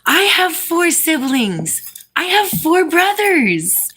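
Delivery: high, drawn-out exclamation; delighted excitement. Real, not acted. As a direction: exclaim it slowly, thrilled to have this in common. Delighted at shared family detail.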